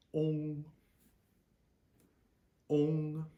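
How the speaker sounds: ONG with a long O, high and level; long O plus NG. Shown as a demonstration. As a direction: hold ONG on one high note, ending in NG. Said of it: "tack on an N G".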